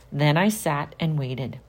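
ordinary delivery

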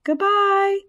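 high, held-out call; yelled goodbye. A narrator's reading. Spiderlings yell farewell to Wilbur, high drawn-out call. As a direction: call it out high, drawing out the end. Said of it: "they yelled".

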